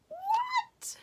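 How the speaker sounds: very high squeaky what; mock surprise. Teacher amazed at the roasting turkey, a very high squeaky what. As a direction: squeal it in disbelief.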